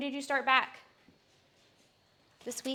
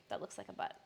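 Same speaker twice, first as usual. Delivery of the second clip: whispered aside; 12 dB quieter, much faster than usual. Real, not acted. Whispered repeat.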